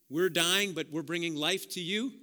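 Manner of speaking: loud, ringing pulpit voice; full-force preaching. Acted. Pastor rousing his congregation, loud preaching. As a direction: preach it out to the whole room.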